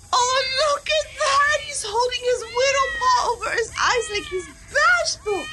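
high, excited cooing; high squeal of delight. Real, not acted. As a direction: melt over the shy kitten. Cooing over bashful kitty.

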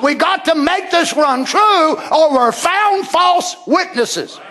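high, ringing pulpit delivery; full-force preaching. Acted. Preacher declaring Christ's return to the congregation, full-force preaching. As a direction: preach it out to the whole church.

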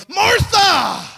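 hammed-up angry bellow, pitched very high; angry shout. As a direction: yell the name in mock outrage. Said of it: "he's not angrily saying something where he goes".

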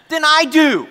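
very high, loud comic outburst; exasperated yell. Retold or imitated. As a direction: cry out in mock frustration. Exasperated comparison with his son.

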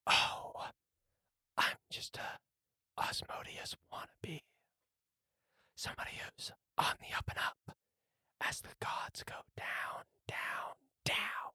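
hushed, raspy villain whisper; smirking whisper. Acted. Golem introducing itself to the player, smirking raspy whisper. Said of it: "kinda smirks and goes".

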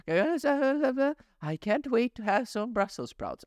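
put-on high, slow tiny-child voice; baby voice. Dad voicing his baby daughter, high and slow. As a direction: coo it like a babbling toddler.

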